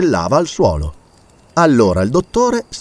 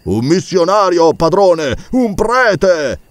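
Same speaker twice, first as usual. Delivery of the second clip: excited servant; 6 semitones higher, much slower than usual. Acted. Servant reports spotting a priest.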